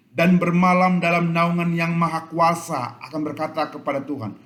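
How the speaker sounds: loud, slow proclaiming of the verse; preaching psalm. Acted. Preacher proclaims Psalm 91 to the church, loud and slow. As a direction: declaim each phrase to the hall.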